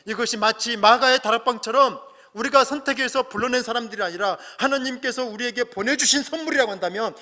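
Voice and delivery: ordinary delivery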